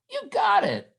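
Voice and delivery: high excited shout of praise. Acted. Drum teacher cheering pupil on.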